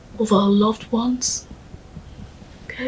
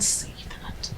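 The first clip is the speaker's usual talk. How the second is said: slow, gentle whisper; 15 dB quieter than usual. Acted. Ogechi soothing her mourning friend, gentle whisper. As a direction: hush her softly and slowly.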